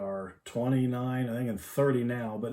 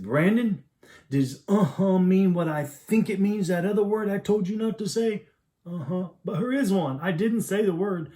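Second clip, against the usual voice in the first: raised, higher exasperated scolding; exasperated stern dad; 7 semitones higher, 4 dB louder than usual. Retold or imitated. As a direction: scold him, voice climbing in frustration.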